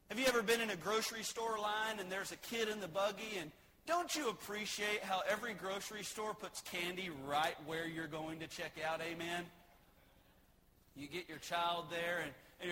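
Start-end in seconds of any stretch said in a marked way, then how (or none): none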